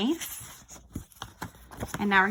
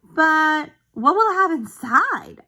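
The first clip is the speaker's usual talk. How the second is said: uncle's question; 7 semitones higher, 5 dB louder, slower than usual. Acted. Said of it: "asked Vernon".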